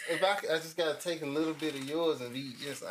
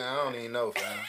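back to nonchalant; 8 semitones lower than usual. Retold or imitated. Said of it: "I just go right back to".

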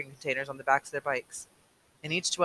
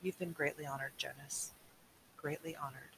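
quiet; 15 dB quieter than usual. Acted.

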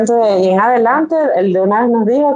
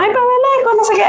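imitating happy mom; 12 semitones higher than usual. Mother voiced in the story.

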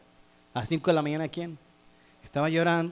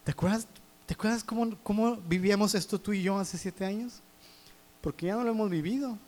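higher and softer, tenderly reminding; God's voice; 4 semitones higher, 4 dB quieter than usual. Retold or imitated. God reminding the preacher in the shower, higher and softer. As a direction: gently recall the closeness of seven years ago. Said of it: "Dios me estaba diciendo".

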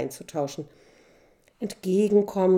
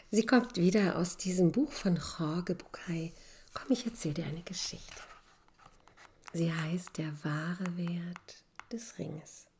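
whispering; 7 dB quieter than usual. A narrator's reading. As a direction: breathe the words like a secret. Intro before the story. (flüsternd)

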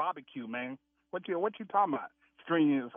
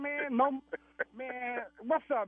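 ordinary talk

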